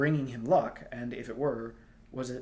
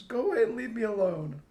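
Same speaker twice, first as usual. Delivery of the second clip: high, louder tearful plea; sobbing; 10 semitones higher, 7 dB louder than usual. A narrator's reading. Star girl sobbing at Tristan, wanting to be alone. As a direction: sob it with face in arms. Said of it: "she sobbed".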